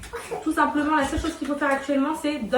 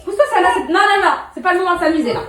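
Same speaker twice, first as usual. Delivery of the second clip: sharp and loud, laying down the law; loud stern scolding; 4 semitones higher, 7 dB louder than usual. Real, not acted. Mother scolding kids at bedtime.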